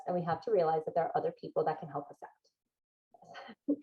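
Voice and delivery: soft unvoiced whisper; a quiet confession. Real, not acted. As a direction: let it slip out as a confiding whisper. Whispered aside.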